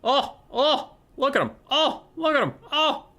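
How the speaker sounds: very high, cooing excitement; gushing admiration. Acted. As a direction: fawn over them in a high, loud voice. Gushing over favourite Grail Knights.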